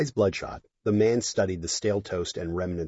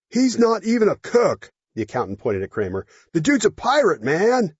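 put-on drunk guy voice; 12 semitones higher, 7 dB louder than usual. Drunk accountant speaking.

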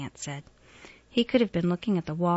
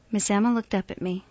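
plain reading voice

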